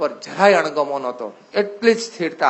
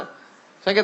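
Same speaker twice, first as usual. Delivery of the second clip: usual speaking voice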